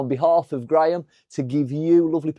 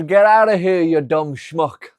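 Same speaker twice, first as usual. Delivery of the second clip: put-on Italian accent; 6 dB louder than usual. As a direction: shout it in the Italian gangster voice. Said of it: "I says".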